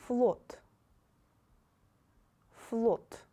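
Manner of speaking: Пол, Флот, Мой, Май each said twice, loud and clear; loud, careful Russian word reading; model words. Shown as a demonstration. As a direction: read each word slowly for repeating. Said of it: "And some new words".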